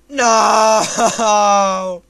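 loud, high no; anguished loud no; anguished shout. Real, not acted. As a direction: yell in despair. Dies in game.

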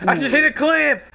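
hyped gamer bro. Acted. Repeated hyped catchphrase yell.

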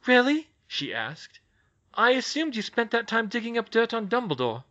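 put-on crisp voice of a young witch, wary; young witch, brisk. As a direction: Hermione taking charge of a tense meeting. Hermione voiced in story.

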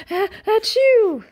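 rising ah, ah into a loud high chew; a hammed-up sneeze burst; acted sneeze. Gerald sneezes again.

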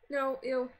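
ordinary talk

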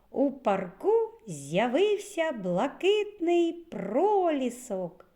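high, slow, emphatic exclamation; ringing exclamation for young pupils. Shown as a demonstration. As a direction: proclaim it with heightened feeling. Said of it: "вимовляється з підвищеною інтонацією".

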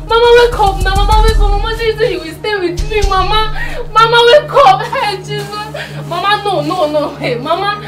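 high, loud sobbing wail, begging her to wake; wailing grief. Acted. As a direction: cry out to her through sobs, voice cracking. Wailing over dead grandmother.

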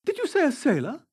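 high, urgent rising question; astonished cry. A narrator's reading. Dorian startled by the keeper's word, crying out. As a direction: demand confirmation, pitched right up. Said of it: "he cried out".

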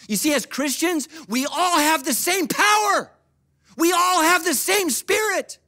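high, loud, drawn-out proclamation; full-force preaching. Real, not acted. Preacher telling Christians they share one Spirit, impassioned shout. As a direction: ring out each phrase to the whole church.

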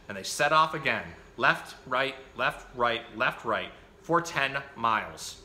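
put-on lively picture-book reading for children; animated bedtime-story reader; story narration. A narrator's reading. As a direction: read the story out with bouncy, emphatic phrasing.

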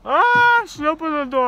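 sudden high yelp of surprise; startled cry. Real, not acted. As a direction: jolt up, caught off guard. Startled by door opening suddenly.

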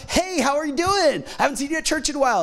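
high-pitched, hearty pastor greeting, re-enacted; overfriendly greeting. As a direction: greet a stranger as if you know them. Retold overfriendly greeting to stranger.

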